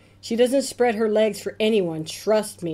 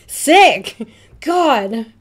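disgusted shout; 2 semitones higher, 8 dB louder than usual. Acted. Texting about a typo, loud disgusted cry. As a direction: yell it in disgust. Reading disgusted text exclamation.

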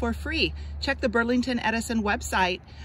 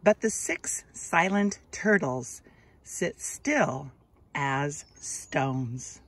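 put-on slow, expressive read-aloud for children; gentle storybook narration, drawn out; story narration; 3 semitones lower, much slower than usual. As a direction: read it like a picture book to little kids, lingering on each line. Reads picture book aloud.

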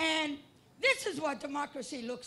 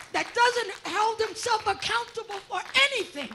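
rising louder and higher, list by list; building rally shout; 3 semitones higher, 7 dB louder than usual. Real, not acted. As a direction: build each "for all the" up to a shout.

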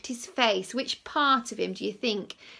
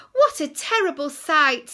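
high loud yell; 6 semitones higher, 11 dB louder than usual. Acted. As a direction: yell with all your might. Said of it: "Timothy yells with all his might".

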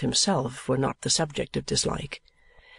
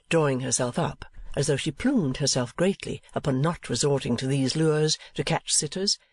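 ordinary delivery, nothing marked

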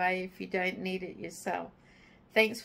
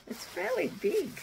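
surprised; 9 semitones higher than usual. Real, not acted. Unwrapping gift, amazed.

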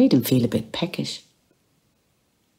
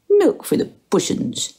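much higher coaxing pet voice; baby talk to cat. Acted. Bloom bending to his cat, coaxing baby talk. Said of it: "he said".

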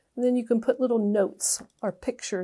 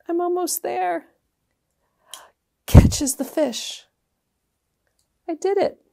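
excited; 8 semitones higher, 6 dB louder than usual. Real, not acted. Catching the paper fish.

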